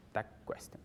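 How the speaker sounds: "tag question" breathed almost without voice; teacher whispering "tag question" after saying it aloud; whispered repeat. Shown as a demonstration. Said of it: "teacher repeats term softly".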